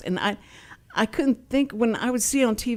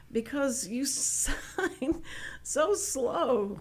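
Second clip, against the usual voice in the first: drawn-out, higher, softer imitation; slow complaint; 4 semitones higher, 5 dB quieter, slower than usual. Deaf convention guests complaining about Texan signing, dragged out slowly. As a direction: stretch every word out very slowly. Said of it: "y'all talk real slow".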